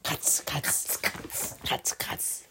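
voiceless, soft crunching sounds; crocodile munching noises. Acted. Storyteller shows a child the crocodile eating, munching noises. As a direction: imitate crisp chomping without voice. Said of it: "这鳄鱼就这样".

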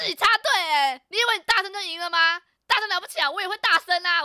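high, loud reenacted yelling; retold angry roar. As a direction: yell back at the line-cutter. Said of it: "我就怒吼欸".

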